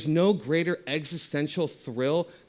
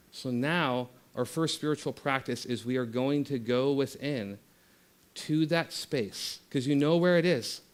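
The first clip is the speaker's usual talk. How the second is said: ordinary talk, nothing marked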